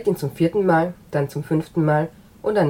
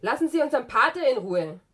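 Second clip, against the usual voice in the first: higher cry without real volume; hammed-up nun crying out; mock yell; 7 semitones higher than usual. Young nun defending the priest, a mock higher cry. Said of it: "und schreit".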